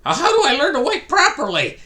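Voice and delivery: high, loud plea breaking into laughter; laughing plea. Retold or imitated. Guy asking the robot dog how to wipe, laughing plea. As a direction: beg for help while cracking up.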